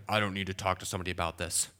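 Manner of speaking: low, quick brush-off; dismissive attitude. Acted. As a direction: mimic someone too proud for help. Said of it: "if you have the attitude of".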